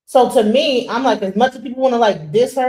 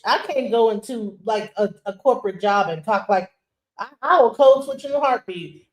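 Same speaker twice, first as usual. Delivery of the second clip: usual speaking voice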